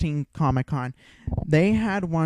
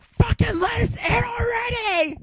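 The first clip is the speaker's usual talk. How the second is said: very high, screamed reenactment of fed-up demand; shrieking mock rage; enraged shout; 4 dB louder than usual. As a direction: scream it out, hammed-up and at the top of your range. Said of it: "You were so enraged".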